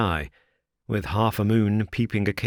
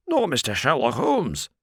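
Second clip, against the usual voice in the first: higher, mildly raised rough voice; a hint of a delighted bellow; roaring prize-fighter; 6 semitones higher than usual. A narrator's reading. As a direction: porter recognising Holmes, a mild rough roar. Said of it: "roared the prize-fighter".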